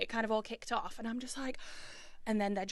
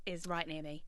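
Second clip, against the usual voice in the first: ordinary talk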